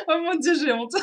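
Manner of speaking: high, loud giggling through the words; real embarrassed laughter. Real, not acted. Speaker ashamed of own English accent, laughing helplessly. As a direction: crack up at your own attempt.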